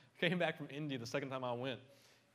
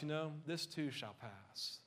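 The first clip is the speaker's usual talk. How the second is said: usual speaking voice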